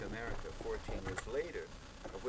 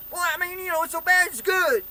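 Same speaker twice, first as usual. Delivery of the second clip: high, very loud heckler's yell; mock a stranger's full-volume heckle; imitated yelling; 18 dB louder than usual. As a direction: holler up at a painter on a ladder.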